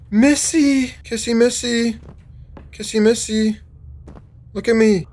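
high gasping call to the character; excited calling out. Real, not acted. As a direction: call her name delighted. Calls out to character.

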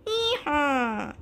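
hee-haw bray; donkey bray. Acted. Narrator voicing the donkey, braying. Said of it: "The donkey said".

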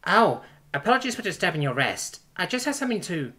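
lightly voiced apologetic princess. Princess intruding on her resting lover, apologetic.

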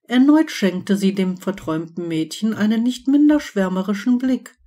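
ordinary delivery, nothing marked

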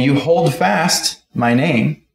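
normal talking voice

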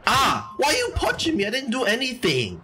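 yelling high in outrage; real cry of protest at being hit; shocked yell, then indignant; pained shout. Real, not acted. Gets punched in game.

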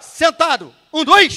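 loud, high order with a punched count; shouted drill command. Shown as a demonstration. Instructor orders the class to sit on his count, shouted drill command. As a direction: shout the class down into their seats. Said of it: "Aí eu vou falar assim".

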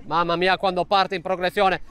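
ordinary talk, nothing marked

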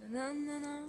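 humming through a mouthful of water; mouth full. Acted. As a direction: hum a tune with cheeks full. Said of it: "chanter une chanson avec la bouche pleine".

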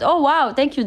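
normal talking voice

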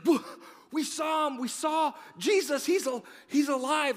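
high, loud, breathless excited yelling; excited shout. Retold or imitated. Disciples bursting in to tell the eleven, shouting excitedly.

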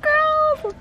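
very high, slow grossed-out whine; disgusted. Real, not acted. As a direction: drag it out in disgust. Disgust at leeches.

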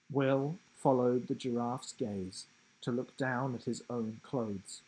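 ordinary delivery, nothing marked

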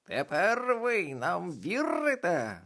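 boasting witch. A narrator's reading. Мала баба Яга хизується перед дітьми. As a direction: show off to the crowd.

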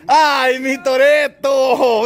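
fond slow cry of delight to the calf; delighted drawn-out call. Real, not acted. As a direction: gush over the calf, slow and high.

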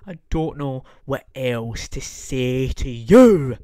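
slow, dragged-out, exasperated delivery; slow drawn-out reprise. Shown as a demonstration. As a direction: stretch it out like you've given up. Same line said twice.

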